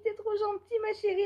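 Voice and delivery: lightly voiced elderly neighbour; touched old neighbour. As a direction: lift your voice to play the grateful neighbour.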